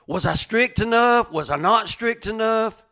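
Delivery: high, loud anxious self-questioning; second-guess yourself, pitch climbing with each question; worried parent doubting themselves aloud; self-judging. Acted. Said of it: "You're always judging yourself".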